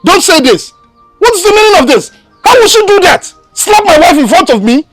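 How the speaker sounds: angry protest. Acted. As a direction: demand justice for his wife. Son protesting angrily to mother.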